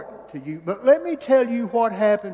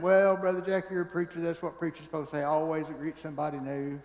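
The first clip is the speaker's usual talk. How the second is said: skeptical listener; 6 semitones lower, 8 dB quieter, faster than usual. Retold or imitated. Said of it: "you might be sitting there saying".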